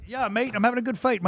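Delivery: put-on half-hearted British accent, dropping mate at the ends. Host mimics the British fighter Gary in a mock interview, in a rough British accent. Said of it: "I can't do it".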